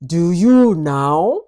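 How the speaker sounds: slow, drawn-out menacing maid; sinister taunt. Acted. Maid sneering at the wife's accusation, slow and menacing. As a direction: let the question curl slowly, mocking.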